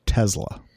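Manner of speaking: "Tesla" said with a buzzed Z for the S. Shown as a demonstration. Correcting the host on the name, "Tesla" with a Z sound. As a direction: pronounce "Tesla" as TEZ-la, Z in the middle. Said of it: "And it's pronounced".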